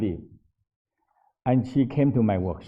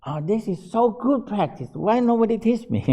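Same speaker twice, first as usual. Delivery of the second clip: excited nun; 9 semitones higher, 4 dB louder than usual. Retold or imitated. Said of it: "And she said".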